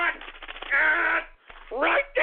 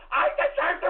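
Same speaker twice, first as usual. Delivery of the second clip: building tearful shout; 3 semitones higher than usual. Acted. Host demands respect from trolls, building tearful shout.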